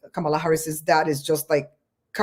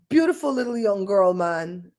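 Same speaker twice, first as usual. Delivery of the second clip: normal talking voice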